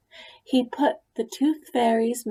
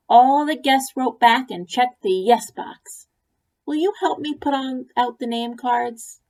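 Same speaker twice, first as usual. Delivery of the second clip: bright raised sister voice, louder; eager big sister; 5 semitones higher, 6 dB louder than usual. A narrator's reading. Ruby eagerly asking her brother for help, lightly voiced. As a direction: hurry through the request to Max. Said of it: "said Ruby".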